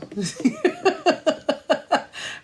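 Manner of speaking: high-pitched burst of laughter. Real, not acted. Laughing at her own old habit of hanging up first, high laugh. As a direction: crack up at herself, high and free.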